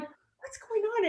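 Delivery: high, soft, drawn-out mock question; retold puzzled question. Recruiter questioning her teary candidate before an interview, puzzled. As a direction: ask it high and slowly, imitating concern.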